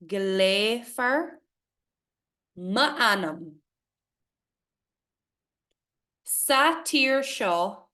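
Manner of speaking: slow, measured recitation; poem reading. Acted. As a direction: read each word carefully, with pauses.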